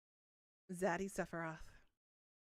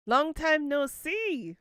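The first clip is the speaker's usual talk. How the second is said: drawn-out greeting; 7 semitones higher, 5 dB louder, much slower than usual. Real, not acted.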